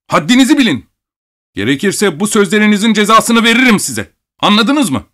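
high, shrill, angry warning; shouted threat. A narrator's reading. Abogin threatening the doctor over his words, shrill shouting. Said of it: "diye bağırdı".

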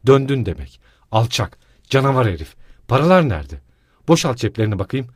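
loud, raging accusation; öfkeli bağırış; furious shouting. Acted. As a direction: scream at the drunk husband. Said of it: "diye haykırdı büyük bir öfkeyle".

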